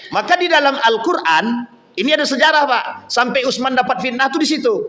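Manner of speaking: ordinary talk, nothing marked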